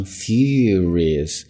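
furious said with a clear diphthong in the middle; teacher modelling furious for learners; careful pronunciation. Shown as a demonstration. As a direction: glide the middle vowel of furious. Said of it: "注意中间是双元音".

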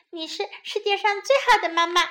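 put-on high, quick child voice; adoring small bunny; little bunny. A narrator's reading. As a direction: gush to mummy, high and fast.

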